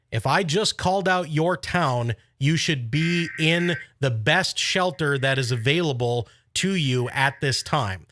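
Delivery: ordinary talk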